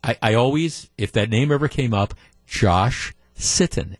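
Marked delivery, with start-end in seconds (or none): 2.5-4.0 Josh Sitton slowed right down, lower, with a careful break between the words; "Josh... Sitton", low, slow and deliberate; clear enunciation. Shown as a demonstration. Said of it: "make sure I clearly enunciated".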